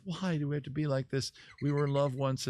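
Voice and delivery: lightly acted wounded plea; mock pleading. Wife crawls to her raging husband, mock pleading. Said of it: "she crawls to him".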